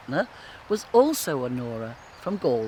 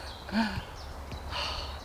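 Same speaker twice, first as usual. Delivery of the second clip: long soft exhale; swooning sigh; 4 semitones higher, 9 dB quieter than usual. Acted. Molly after remembering the kiss, long breathy sigh. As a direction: sigh out slowly after the rapture.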